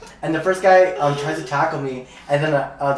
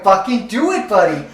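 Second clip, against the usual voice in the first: 1 taunting shout; 9 semitones higher, 4 dB louder than usual. Retold or imitated. Retold taunt before a fight.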